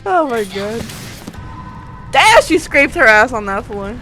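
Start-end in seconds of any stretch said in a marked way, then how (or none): none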